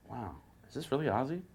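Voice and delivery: hushed inner thought. Retold or imitated. Groggy guitarist wondering who is calling, hushed thought. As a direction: think it aloud, softly amazed. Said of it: "I'm thinking".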